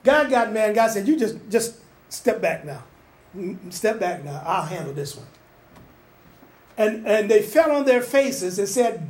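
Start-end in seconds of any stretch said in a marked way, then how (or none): 1.0-5.3 low, soft, settling voice; calm reassuring God. Retold or imitated. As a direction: quietly tell him to step back. God speaking calmly to Moses.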